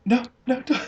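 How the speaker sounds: high, laughing "no, don't"; laughing plea. Retold or imitated. Embarrassed girlfriend begging him not to tell, laughing. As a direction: beg him to stop through laughter. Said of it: "she's like".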